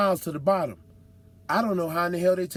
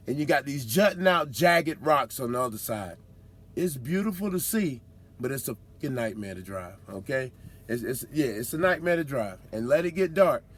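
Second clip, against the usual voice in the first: usual speaking voice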